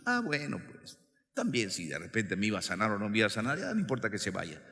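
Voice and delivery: low, quiet, indifferent shrug; acted half-hearted resignation; giving up. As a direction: let it go, muttered low and quick. Acts blind men giving up.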